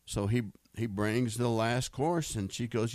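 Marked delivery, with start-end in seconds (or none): none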